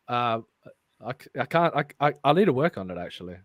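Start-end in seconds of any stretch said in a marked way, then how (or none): none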